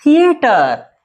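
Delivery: high, ringing dictation call; announcing example word. Shown as a demonstration. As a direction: call it out high, then let it fall. Teacher calls out the example word. (ऊँचे सुर में शब्द पुकारते हुए)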